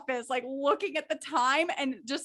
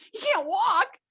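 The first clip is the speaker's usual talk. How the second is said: mock-panicked driver, higher and louder; panicking driver; 10 semitones higher, 4 dB louder than usual. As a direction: retell the driver freaking out in a high voice. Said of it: "he's panicking".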